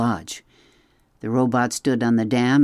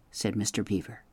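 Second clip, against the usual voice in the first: ordinary delivery, nothing marked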